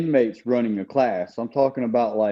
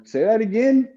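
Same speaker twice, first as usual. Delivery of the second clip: higher, daring him to repeat it; incredulous challenge; 10 semitones higher than usual. Retold or imitated. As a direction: dare him to say it again. Retold challenge after insult.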